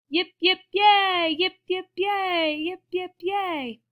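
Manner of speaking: high rhythmic crowd cheer; three cheers. Acted. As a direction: chant it gleefully, pitched high.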